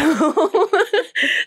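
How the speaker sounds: high-pitched laugh; self-conscious laugh. Real, not acted. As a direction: laugh at your own worry. Laughs at own recasting worry.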